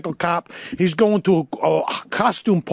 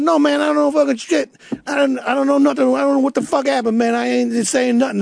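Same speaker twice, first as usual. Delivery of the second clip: higher, tough street denial; defiant suspect; 7 semitones higher than usual. Retold or imitated. Suspect refusing to talk to detectives, higher and defiant. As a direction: stonewall the cops. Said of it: "he goes".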